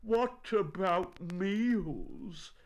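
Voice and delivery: high, slow, drawn-out lament; plaintive bear worrying slowly; gloomy. A narrator's reading. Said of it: "said Winnie the Pooh gloomily".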